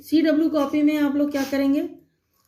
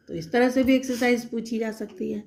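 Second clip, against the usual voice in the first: ordinary delivery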